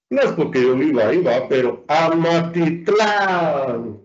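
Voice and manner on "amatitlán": Amatitlán drawn out, leaning on the final "tlán"; stress on final syllable. Shown as a demonstration. Teacher showing the class the stressed syllable, Amatitlán stressed on "tlán". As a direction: stretch the last syllable of Amatitlán. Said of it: "¿dónde está la sílaba tónica?".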